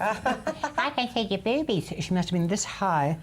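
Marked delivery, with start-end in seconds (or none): 0.0-1.9 lightly voiced child, drawn out slowly; slow little child voice. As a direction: repeat it slowly like a small child. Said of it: "a little voice from next door says".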